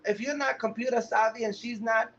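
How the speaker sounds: ordinary talk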